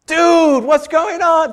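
high, loud exasperated call; incredulous shout. Acted. Preacher to the man facing tanks, incredulous shout. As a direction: holler in disbelief. Exclaims at protester's recklessness.

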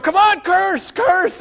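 high, loud mocking jeer; taunting bullies. Sixth graders goading the boy on the bus, high loud jeer. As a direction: egg him on like a pack of bullies.

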